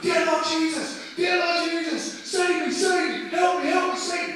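high, drawn-out screamed plea; imitated screaming. Preacher acts the man behind him praying in terror, screaming. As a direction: wail it loudly like a panicked passenger. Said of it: "the guy behind me starts screaming".

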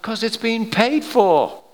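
high, drawn-out exasperated answer; emphatic retort. Acted. Preacher answering the shocked objector, exasperated retort. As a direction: spell out the obvious, high and slow.

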